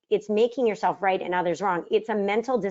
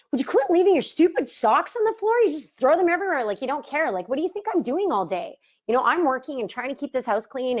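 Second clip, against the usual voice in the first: wife nagging husband about his socks, in a higher exasperated voice; mock nagging rant; 6 semitones higher than usual. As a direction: snap at him about the socks like a fed-up partner. Nagging contrast to calm request.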